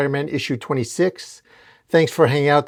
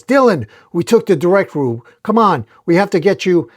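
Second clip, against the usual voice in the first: higher, louder acted call; urgent yell; 6 semitones higher, 5 dB louder than usual. A narrator's reading. Venom breaking through the ceiling to Dylan, urgent yell. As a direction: yell out to rescue someone. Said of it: "and yells".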